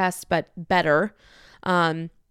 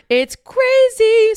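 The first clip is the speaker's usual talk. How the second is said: high, loud, drawn-out squeal; over-the-top amazed shriek; scandalized; 10 dB louder, much slower than usual. Real, not acted. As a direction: squeal it in gossipy shock. Excited reaction to gossip.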